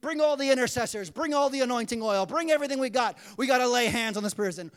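loud, hammed-up urgency; rally everyone in a mock frenzy; acted churchgoer's loud, frantic commands; worked up. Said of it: "worked up".

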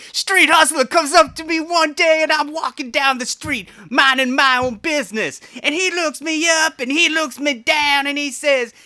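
high, loud, hammed-up rock-star jive; [name] impression. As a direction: ham up Paul's street-talk voice. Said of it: "doing it like Paul".

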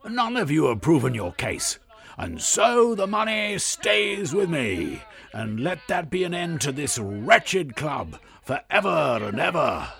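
high, very loud ranting shout; yelled. Acted. Blackanor on a chair declaring to the club, a high ranting yell. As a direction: scream it from the chair at everyone. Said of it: "and also yelled".

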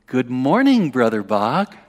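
sing-song taunting greeting, swooping up on morning; dripping with mock friendliness, drawn out; teasing. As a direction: needle him with a provocative good morning. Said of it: "with a teasing sort of spirit and attitude".

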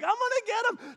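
mock eager attack. Peter attacking with sword.

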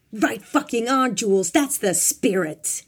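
loud, slow, drawn-out cheer; rousing shout. A narrator's reading. Boss slapping his driver's shoulder, shouting approval. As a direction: cheer him on, stretching the words. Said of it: "Bob shouted and slapped him on the shoulder".

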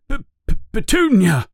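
high, stuttering cry of alarm; stammering yell. A narrator's reading. Vernon calls his wife after reading the letter, stammering yell. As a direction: stumble on the P, then cry the name out high. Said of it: "he yells".

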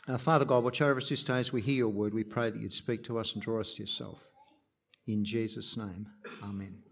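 fast, soft opening prayer, fading to near silence; quick prayer. Real, not acted. Pastor opens the sermon in prayer, quick soft prayer.

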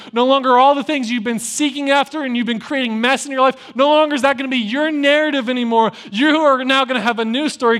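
high, loud, building declaration; preaching at full force. Acted. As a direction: thunder it from the pulpit. Preaching God's renaming of Jacob.

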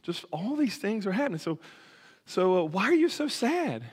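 amazed friend. Acted. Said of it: "He's like".

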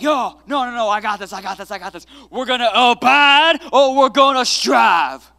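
put-on channel a fiery old-time revival preacher; Southern preacher impression. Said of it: "Get my best, like, Southern Billy Graham on".

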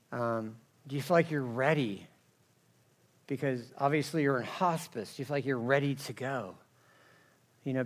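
soft, tender questions at a bedside; gentle pastoral. Retold or imitated. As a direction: quietly ask a dying man if he's ready. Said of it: "I'm like".

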